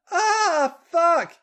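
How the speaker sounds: very high, loud yell; acted high-pitched outcry. A narrator's reading. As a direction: cry out at the top of the range. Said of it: "Charlotte yelled".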